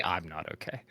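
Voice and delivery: dropped low and soft; mock-emo hook, suddenly subdued; song hook imitation. Player finishing My Chemical Romance's hook, low and subdued. Second half of quoted hook.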